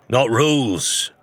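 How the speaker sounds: ordinary delivery, nothing marked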